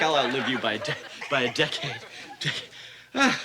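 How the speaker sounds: winded, gasping for air; out of breath. Acted. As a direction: run out of breath mid-sentence. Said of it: "Oh, I'm winded".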